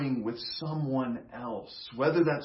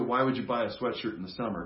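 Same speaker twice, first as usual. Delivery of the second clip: ordinary talk, nothing marked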